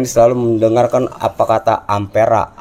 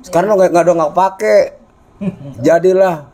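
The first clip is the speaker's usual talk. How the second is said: raised, rousing street-rally call; 8 semitones higher than usual. Real, not acted. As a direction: urge them on like a protest leader. Urging people to join protest.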